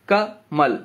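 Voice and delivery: कमल broken as "ka", pause, "mal"; कमल with a gap after the first syllable; split into syllables. Shown as a demonstration. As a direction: say कमल in two beats, short ka then mal. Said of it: "कमल को जब हम तोड़ेंगे".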